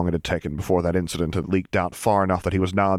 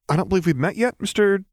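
higher voice left hanging on Mister; meaningful trail-off; 12 semitones higher than usual. A narrator's reading. Luke asking the clone's name, trailing off meaningfully. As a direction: let the question hang, trailing off. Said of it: "He trailed off meaningfully".

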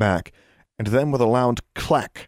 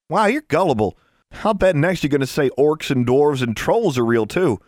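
higher-pitched, scornful schoolgirl voice; teasing girl; 6 semitones higher, 3 dB louder than usual. Acted. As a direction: tease a gullible classmate in a kid's voice. Voices Leah teasing Pete.

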